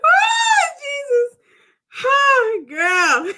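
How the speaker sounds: high squeals of laughter; crying with laughter. Real, not acted. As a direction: laugh till you cry. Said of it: "I got tears running down my eyes".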